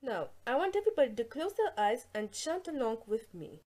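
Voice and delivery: soft, hushed, calming instruction; calm meditation voice. Acted. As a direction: settle them in gently. Meditation teacher opening class.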